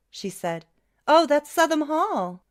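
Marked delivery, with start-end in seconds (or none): 1.1-2.4 high, loud recognition; light up at the sight; surprised. A narrator's reading. Daughter recognising the hall.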